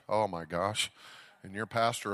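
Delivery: usual speaking voice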